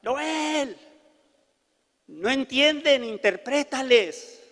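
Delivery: high-pitched mock call, last word drawn out; imitated mother calling out. Mother calling son to interpret, imitated high call. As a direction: call your son over like an insistent mother. Mamá lo llama para interpretar.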